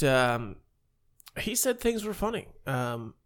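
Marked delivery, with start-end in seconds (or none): none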